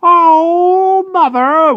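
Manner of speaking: very high, loud, drawn-out whine; whining crow. A narrator's reading. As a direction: whine like a wronged bird. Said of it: "whined the crow".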